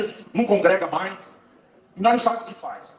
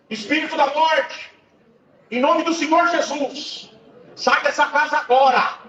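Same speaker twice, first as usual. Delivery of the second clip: higher and louder, slow, commanding the spirit out; commanding prayer shout; 8 semitones higher, 6 dB louder, slower than usual. Retold or imitated. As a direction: order the spirit to leave with full force. Expelling the spirit of death in prayer. (grito de ordem na oração)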